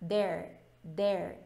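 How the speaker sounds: "there" said like "dere", with a hard Portuguese d; "there" mispronounced as "dere", no air; wrong d sound. Shown as a demonstration. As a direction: say "there" with the tongue stopping the air, like a d. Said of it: "quando você fala errado".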